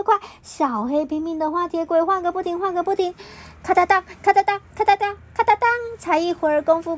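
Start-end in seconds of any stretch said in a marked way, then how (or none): 3.6-5.7 racing train noise. Acted.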